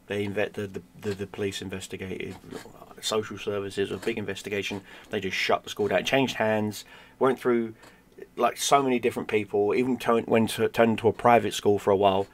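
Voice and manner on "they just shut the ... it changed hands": ordinary delivery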